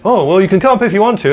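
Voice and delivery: loud, high, jolly compere calling from stage; hammed-up loud compere; compere's voice. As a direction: boom it out like a club compere. Compere Eugene voiced in anecdote.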